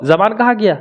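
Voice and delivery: ordinary delivery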